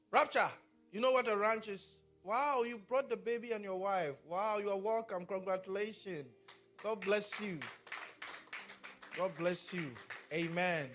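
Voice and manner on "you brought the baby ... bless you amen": normal talking voice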